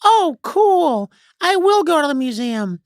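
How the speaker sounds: put-on very high-pitched child voice; high, childish delight; excited kid. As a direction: play a kid suddenly won over. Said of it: "you're talking to a kid".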